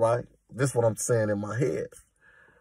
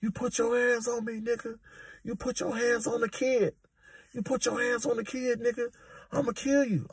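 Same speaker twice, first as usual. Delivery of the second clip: mock yelling; 12 semitones higher, 6 dB quieter than usual. Inmate staging a fake fight for the guards, high mock yell. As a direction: squeal the threats up high like a fake tantrum. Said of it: "He come out yelling and screaming".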